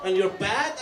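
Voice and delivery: ordinary delivery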